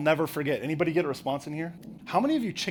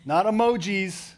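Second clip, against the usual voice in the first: ordinary talk, nothing marked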